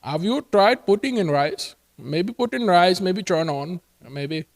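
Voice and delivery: put-on Indian-accented tech support advice; mock Indian accent. Agent suggesting rice to caller, mock Indian accent. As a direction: play an Indian helpdesk agent. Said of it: "they speak to you".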